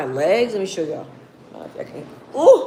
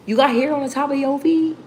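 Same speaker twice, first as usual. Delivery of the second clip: put-on high, insistent kid question; mock childish pestering, asked over and over; pestering little kid; 8 semitones higher than usual. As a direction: imitate a nagging child, pitched up. Mimicking nagging kids' repeated question.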